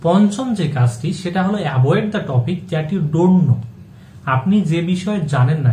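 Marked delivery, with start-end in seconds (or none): 1.6-3.6 each English word spaced out slowly; slow, deliberate rule. Shown as a demonstration. Teacher slowly spelling out the rule to listeners, deliberate.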